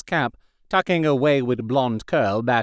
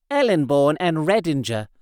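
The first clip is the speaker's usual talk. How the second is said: ordinary delivery, nothing marked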